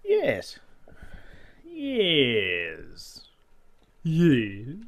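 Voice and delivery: drawn-out, oddly pitched affirmations; silly weird noises. Pretend. Builder pleased with the work, making weird noises of approval. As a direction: play around with each word as a silly noise. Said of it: "I'm just making weird noises now".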